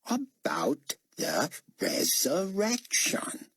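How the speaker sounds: slow, talking down, lightly Australian; slow, condescending. A narrator's reading. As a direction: spell it out like to a slow child. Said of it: "The man spoke slowly, condescendingly".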